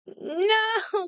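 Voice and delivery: high, drawn-out cry of frustration; dismayed wail. Real, not acted. Painter seeing the light go out again, high dismayed wail. Light fails a second time.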